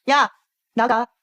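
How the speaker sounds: ordinary talk, nothing marked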